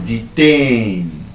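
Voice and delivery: detain with TAIN stretched long; short DE, long falling TAIN in detain; stress on second syllable. Shown as a demonstration. Teacher giving a verb example, detain drawn out on the second syllable. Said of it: "it is stressed on the second syllable".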